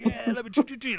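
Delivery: high, muddled mimicry of the officer; searching officer. Officer searching the traveler, high mimicked patter. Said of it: "He's like".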